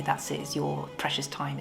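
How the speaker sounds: ordinary talk, nothing marked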